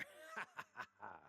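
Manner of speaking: soft, trailing run of ha-has; hearty laugh. Acted. Friend heads off to the bar, merry laugh. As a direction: laugh it out merrily, dying away. Cheerful laugh after rallying cry.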